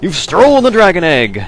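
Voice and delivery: high, loud shout of thief; hammed-up alarm of a startled guard; yelling guard impression. Mimicking the NPC who screams at the thief. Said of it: "he's like".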